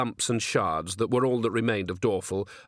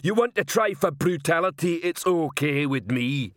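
high-pitched troll challenge; threatening troll; 6 semitones higher than usual. Acted. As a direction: dare him as a troll cop.

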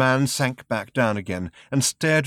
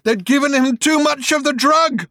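high, much louder raving shout; frantic shout; 9 dB louder than usual. Acted. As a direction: hurl it at them in a frenzy. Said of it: "shouted at them".

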